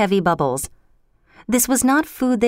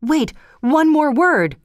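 crying out; 8 semitones higher, slower than usual. A narrator's reading. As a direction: call after the vanishing head, stretched out. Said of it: "cried Macbeth".

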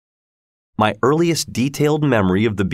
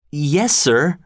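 higher, meek reply; timid boy; 5 semitones higher than usual. Acted. Pip agreeing to the convict, high and meek. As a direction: obedient and frightened. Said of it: "I said".

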